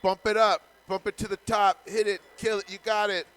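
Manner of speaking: shouted encouragement, high and loud; loud cheering. Real, not acted. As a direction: cheer the player on at full volume. Cheering on the player loudly.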